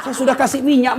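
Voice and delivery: usual speaking voice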